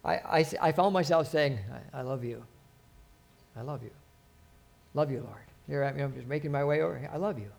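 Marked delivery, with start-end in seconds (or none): 1.9-7.5 much softer and lower, a private whisper-like prayer; hushed loving prayer under the breath; tender murmured prayer. Retold or imitated. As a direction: murmur it to God as you walk. Retold murmured prayer to God.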